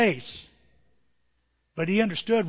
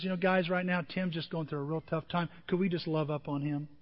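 gentle request; 2 semitones lower, 8 dB quieter than usual. Retold or imitated. Wife gently asking the group.

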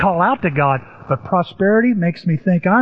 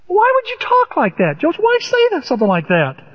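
much higher, baffled asking; incredulous question; 11 semitones higher than usual. Retold or imitated. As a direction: sound puzzled at Joseph's choice. Preacher mock-questions Joseph.

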